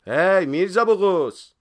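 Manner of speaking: loud, high, drawn-out call; sudden shout. Acted. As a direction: yell slowly after someone walking away. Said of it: "یه مرتبه داد زد". (فریاد بلند و کشیده)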